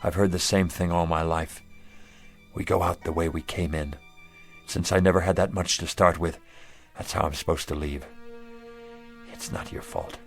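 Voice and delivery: low, soft, defeated voice; gentle, downcast surrender; resigned acceptance. Acted. Gives up and forgives the official.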